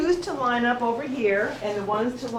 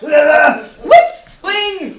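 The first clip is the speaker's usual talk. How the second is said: silly clown; 7 semitones higher, 14 dB louder than usual. Pretend. Silly sound game, wild calls.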